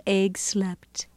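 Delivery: soft, whispery narration; hushed. A narrator's reading. Narrator as the little egg slept, hushed. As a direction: drop to a near whisper.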